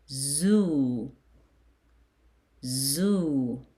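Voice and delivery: "Zoo" with a long held oo; "Zoo" stretched out, arching then falling; long O syllable. Shown as a demonstration. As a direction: model "Zoo" slowly for repeating. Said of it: "you add".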